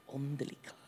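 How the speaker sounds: hushed, near-voiceless puzzled question; baffled whisper. Retold or imitated. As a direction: whisper it to yourself in disbelief. Baffled aside at odd phrase.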